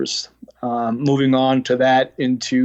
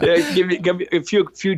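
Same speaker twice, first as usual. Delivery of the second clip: laughing; 5 semitones higher than usual. Real, not acted. Laughing at accent joke.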